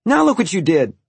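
high, loud accusing snap; snapped. A narrator's reading. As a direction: snap at a brother in blame. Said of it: "I snapped at Walter".

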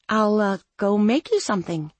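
Claire. A narrator's reading.

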